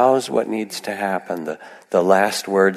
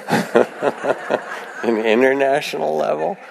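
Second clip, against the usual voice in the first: slow, higher-pitched through a laugh; laughing at your own quip; amused laughter; 6 semitones higher, much slower than usual. Real, not acted. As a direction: let a chuckle run into the question.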